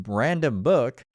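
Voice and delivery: ordinary talk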